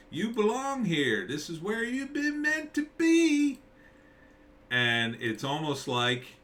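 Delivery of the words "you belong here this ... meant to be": high, slow, eerie sing-song voice; creepy townsfolk. Retold or imitated. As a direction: drag it out, unsettling and otherworldly. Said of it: "says weird, creepy stuff like".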